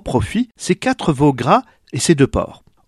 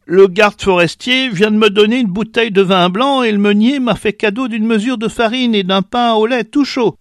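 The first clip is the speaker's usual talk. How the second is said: high, kindly, slightly louder voice; generous old woman; 9 semitones higher, 3 dB louder than usual. A narrator's reading. Old woman offers the mother shelter, high and kindly. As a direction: offer shelter and supper warmly.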